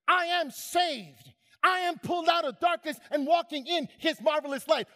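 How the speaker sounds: rising preacher's cadence, I am hammered each line; preaching declaration. Acted. Preacher declaring the believer's identity to the congregation, building fervor. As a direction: proclaim it like a creed from the pulpit.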